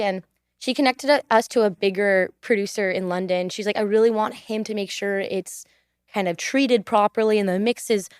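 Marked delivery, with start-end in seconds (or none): none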